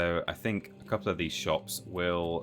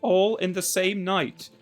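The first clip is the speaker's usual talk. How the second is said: high, slow overacted line read; hammy voice actor; 13 semitones higher, 5 dB louder, slower than usual. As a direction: ham it up like a bad dub. Mimics a game voice actor.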